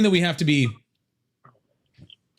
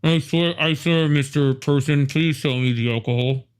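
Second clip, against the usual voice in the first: slow pleading fake-adult voice; hammed-up teen asking for alcohol; mock underage voice. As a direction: beg like a kid pretending to be of age. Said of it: "the worst impression of somebody over eighteen".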